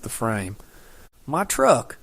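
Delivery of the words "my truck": raised, higher panicked yell; urgent call of alarm, voice pushed up. Acted. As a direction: shout after the truck in a panic. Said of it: "I yelled".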